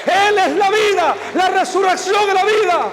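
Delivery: put-on old man silencing the lion, shouting triumphantly; booming proclamation. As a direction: thunder out the old man's declaration of power. Old man proclaims God's power.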